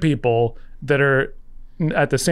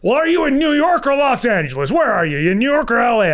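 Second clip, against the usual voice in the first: put-on pitched up, impatient old showman grilling; George Schlatter; 9 semitones higher than usual. Producer demanding where the writer lives, high impatient grilling. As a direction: bark the questions like a pushy old producer. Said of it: "He goes".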